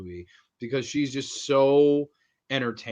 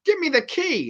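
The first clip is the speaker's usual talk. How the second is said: higher, urgent "give me the key"; pleading victim; 13 semitones higher than usual. Retold or imitated. Voicing the victim.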